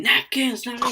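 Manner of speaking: loud, high-pitched imitated yell; mock yelling. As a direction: yell it like an irate customer. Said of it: "She starts yelling".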